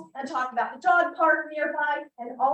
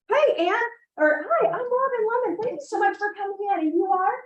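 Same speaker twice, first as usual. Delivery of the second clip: energetic greeting; 7 semitones higher than usual. Shown as a demonstration. Agent walks up to a visitor at the open house, energetic and welcoming. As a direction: step up and greet them like you mean it. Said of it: "get up and say like this".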